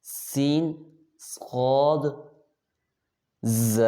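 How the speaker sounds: "سین، صاد، ز" each with a sustained sibilant; name each letter slowly, drawing out its whistle; whistling letters. Shown as a demonstration. Teacher lists whistling letters, "سین، صاد، ز" with long sibilants. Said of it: "تین حروف ہیں سیٹی والے".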